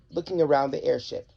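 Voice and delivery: plain reading voice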